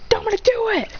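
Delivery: high urgent call-out; acted high battle order; urgent command. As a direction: yell for your partner to act.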